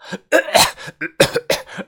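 被掐后的干咳; 捂着脖子猛咳; 剧烈咳嗽. A narrator's reading. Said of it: "使劲儿的咳嗽了一阵". (被掐后剧烈咳嗽)

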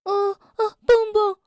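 startled little animal calling his friend; nervous whimpering call; scared stammer. Acted. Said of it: "跳跳被吓了一跳".

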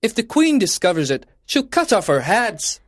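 high, quick, worried character voice; high-pitched, hurried worry; anxious gardener. A narrator's reading. Gardeners fear the Queen's punishment.

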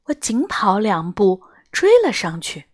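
ordinary delivery, nothing marked

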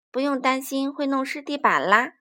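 ordinary delivery, nothing marked

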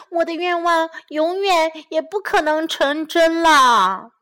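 high, loud wailing dismay; 绝望地喊叫; distressed cry. A narrator's reading. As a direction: lament like a worried mouse. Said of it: "老鼠喊叫了起来".

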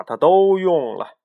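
ordinary delivery, nothing marked

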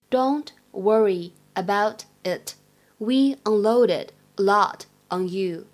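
slow, word by word, with small gaps; slow demonstration. Shown as a demonstration. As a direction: model it slowly for learners, separating each word. Teacher repeats it slowly, phrase by phrase. (放慢速度逐词示范朗读)

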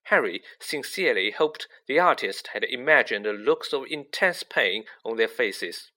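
plain reading voice

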